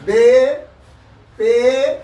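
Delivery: "बे" and "पे" called out loud and clear; letter names recited. Shown as a demonstration. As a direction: recite the Urdu letters "बे", "पे" firmly to the class. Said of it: "अलिफ". (अक्षरों के नाम ज़ोर से)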